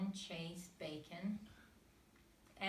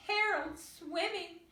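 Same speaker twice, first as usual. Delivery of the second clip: much higher, louder joyful shout; 13 semitones higher, 9 dB louder, slower than usual. A narrator's reading. Sisters seeing Harold swim, joyful shout. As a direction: cheer it out. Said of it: "shouted Dora".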